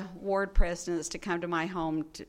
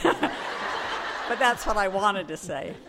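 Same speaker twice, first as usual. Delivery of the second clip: laughing; 5 dB louder, much slower than usual. Real, not acted. Laughs at own admission.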